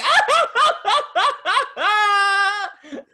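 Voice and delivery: long, high-pitched staccato wail, loud; high gushing squeal. Retold or imitated. Fan reacting to Dolly's big line, high squealing outburst. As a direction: shriek it out in high bursts.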